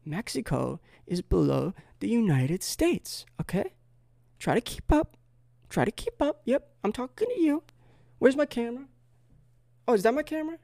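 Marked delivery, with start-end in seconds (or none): 4.4-10.6 very high, put-on squeaky talk; mock condescension. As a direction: pitch it way up, talking down to them. Mock lecture to slow viewers.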